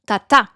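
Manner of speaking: French rhythm, weight on the final "ta"; light "ta", then strong "ta"; last-syllable stress. Shown as a demonstration. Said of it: "you stress la dernière syllabe".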